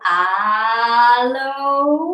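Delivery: very slow, stretched aloha sliding upward into laughter; long rising chant ending in a laugh. Shown as a demonstration. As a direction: draw the word right out, then burst into ha-ha. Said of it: "So you go".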